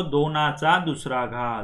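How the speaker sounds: दोनाचा दुसरा घात said slowly and clearly, each syllable spelled out; Marathi pronunciation. Shown as a demonstration. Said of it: "इथं क्या बोलते हैं इसको?".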